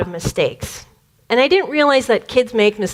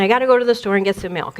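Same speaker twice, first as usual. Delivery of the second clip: normal talking voice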